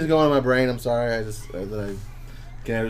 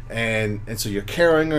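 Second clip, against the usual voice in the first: ordinary talk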